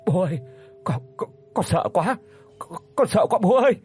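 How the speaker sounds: cling to father and stammer in fear; trembling voice. A narrator's reading. Frightened daughter clutching her father, trembling and stammering. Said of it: "cất cái giọng run rẩy".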